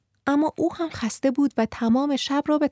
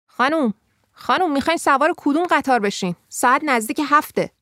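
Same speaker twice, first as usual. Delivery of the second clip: raised, higher voice rousing a sleeper; urgent wake-up call; 5 semitones higher, 3 dB louder than usual. A narrator's reading. Stranger shaking a sleeping woman awake, urgent. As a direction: call out insistently to wake someone.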